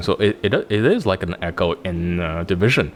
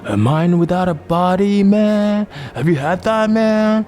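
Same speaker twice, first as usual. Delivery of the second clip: high, loud laid-back surfer dude; 8 semitones higher, 4 dB louder than usual. Retold or imitated. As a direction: chill surfer bro, stretched out. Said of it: "imagine if she said it in, like, the, um, the surfer voice".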